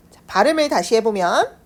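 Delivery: ordinary talk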